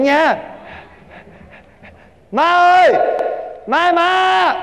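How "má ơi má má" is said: calling out. Acted. Son calling mother urgently.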